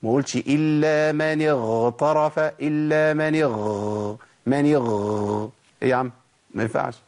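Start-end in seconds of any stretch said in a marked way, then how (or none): 0.4-5.5 اغترف with a very long, rattling gargled gh; gargled ghayn. Shown as a demonstration. Teacher showing listeners a gargling reciter, exaggerated gargle. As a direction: stretch the gh of اغ- into a sustained gargle. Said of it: "ما وصلش صوت الغين إلى الغرغرة".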